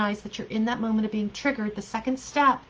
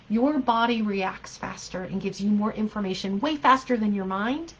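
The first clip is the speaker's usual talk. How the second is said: ordinary delivery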